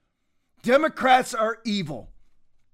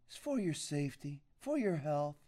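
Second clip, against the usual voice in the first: much softer, mock-soothing and lower; sarcastic mock; 5 semitones lower, 13 dB quieter than usual. Host mocks business shutdowns for your health, soft sarcastic coo. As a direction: coo the excuse back with contempt.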